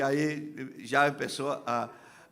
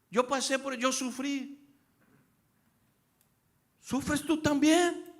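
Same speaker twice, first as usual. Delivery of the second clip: very high-pitched, mock indignant cry; hammed-up bitter demand; acted resentful outburst; 12 semitones higher, 4 dB louder than usual. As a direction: throw it out high, louder at the end.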